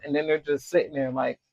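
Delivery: ordinary talk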